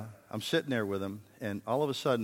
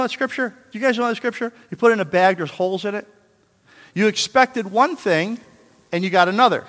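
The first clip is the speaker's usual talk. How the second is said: emphatic preaching; 8 semitones higher, 9 dB louder than usual. Acted. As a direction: drive the scripture home to the crowd. Preaching at full force.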